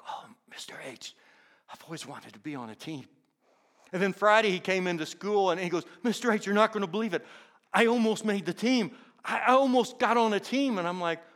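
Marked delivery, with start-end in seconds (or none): 0.1-3.1 hushed confession. Retold or imitated. Boy confides his dream. 6.0-10.8 put-on quick, higher, louder boyish rush; eager news. Boy almost made team.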